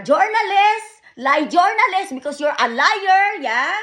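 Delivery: high, loud accusing shout; angry outburst. Real, not acted. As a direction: hurl the accusation at them. Accusing Denmark of lying.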